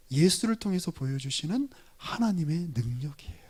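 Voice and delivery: soft low whisper; whispered climax of the sermon. Real, not acted. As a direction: hush the key line.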